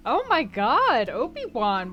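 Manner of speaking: normal talking voice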